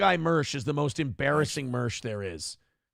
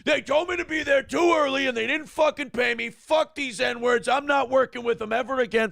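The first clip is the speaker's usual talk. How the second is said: ranting; 7 semitones higher than usual. Retold or imitated.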